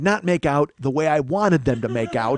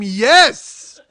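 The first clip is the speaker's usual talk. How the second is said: high, loud triumphant yell; explosive fan's cheer; excited scream; 8 semitones higher, 9 dB louder than usual. Retold or imitated. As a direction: scream it in sheer delight. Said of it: "I wanted to scream".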